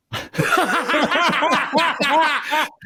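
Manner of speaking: big, high laugh at his own story; hearty laugh. Real, not acted. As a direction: burst out laughing at the punchline.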